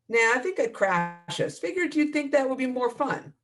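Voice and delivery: high, smug teasing voice; cocky teasing. Acted. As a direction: tease her like a cocky pilot. Lyle's cocky reply.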